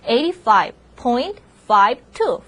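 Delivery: "five two" read slowly as two separate digits after "point". Shown as a demonstration. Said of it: "숫자 하나하나 읽어주면". ("five two"를 한 자리씩 따로 천천히 읽기)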